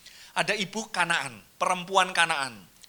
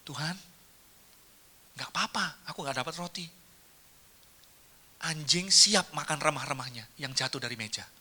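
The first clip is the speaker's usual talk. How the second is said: humble reply; 3 semitones lower, 7 dB quieter than usual. Acted. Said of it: "Dan dia ngomong".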